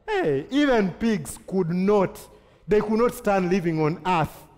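ordinary delivery

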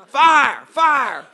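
full-voiced shout of fire; crying out. Preacher calling down fire on the prisoners, loud shout. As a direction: shout it over the crowd.

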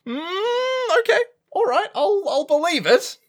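squeaky disbelief; high, incredulous bafflement; baffled high squeak. Real, not acted. Baffled reaction to plot twist.

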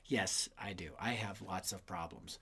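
hushed whisper to the audience; self-mocking whispered confession; whispered aside. Speaker repeats as aside.

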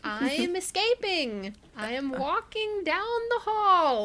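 mock-proud declaration, slow and loud; deadpan announcing. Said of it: "she's like".

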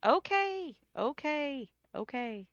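high "okay" sinking with each repeat; stretched, placating okays that tail off; anxious compliance. Acted. Daughter anxiously agrees, high then sinking.

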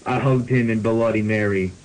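normal talking voice

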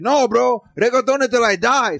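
hammed-up Miami bro; imitating [name]. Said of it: "And he goes".

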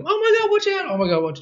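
high-pitched panicked exclamation; sudden high mock cry of alarm; mock yell. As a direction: imitate a passenger yelping out a warning. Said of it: "if someone yells out".

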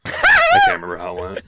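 squeaky high voice breaking into laughter; laughing. Real, not acted. Embarrassed after the impression, words laughed out very high. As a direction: giggle through the words.

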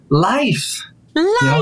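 "Life" said high and drawn out with a falling sigh; dramatic sigh of life. Shown as a demonstration. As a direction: sigh "Life" like a weary excuse. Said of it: "but you could also say it like this".

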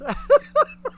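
sudden high cackle; high burst of laughter. Real, not acted. Host reacting to a chat joke, high laugh. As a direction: let a squeal of laughter escape. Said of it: "Reason why I'm laughing".

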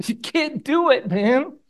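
mock-incredulous high voice; amused disbelief. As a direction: toss it off as if the idea is absurd. [name] dismissing the question.

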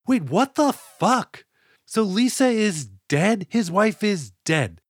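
slow, high-pitched shock; can't believe what you just heard; stunned disbelief. Real, not acted. Shocked reaction to dead wife.

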